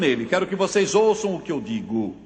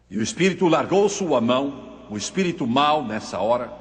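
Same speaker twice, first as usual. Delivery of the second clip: usual speaking voice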